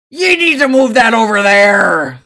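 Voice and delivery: loud barked order to workers; commanding shout. Acted. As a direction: bellow it like a foreman. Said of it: "sounding completely different than everybody else".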